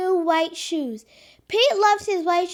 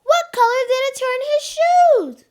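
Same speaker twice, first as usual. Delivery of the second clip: louder, higher storybook voice; lively read-aloud; 5 semitones higher, 5 dB louder than usual. Acted. Girl reads Pete the Cat to preschoolers. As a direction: read with big excitement for kids.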